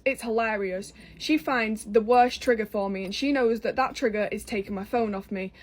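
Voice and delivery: ordinary talk, nothing marked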